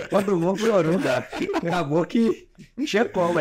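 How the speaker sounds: high-pitched, laughing through the words; real laughter breaking into the telling. Real, not acted. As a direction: tell it while cracking up with laughter. Laughs retelling that everyone cried.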